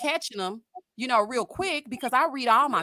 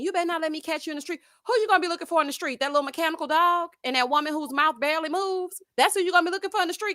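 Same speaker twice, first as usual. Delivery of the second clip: fast mocking retort; 5 semitones higher, faster than usual.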